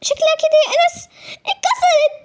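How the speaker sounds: put-on high tearful girl's voice with a laugh breaking through; little sister's pained voice. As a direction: play the hurt little sister, half crying. Girl hurt, fell from bed.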